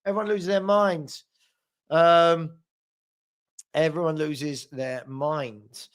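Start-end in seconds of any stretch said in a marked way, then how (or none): 3.7-5.9 low, drawn-out repeat; weighty, word-by-word delivery; slow, deliberate. Real, not acted. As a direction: spell it out slowly and drop the pitch.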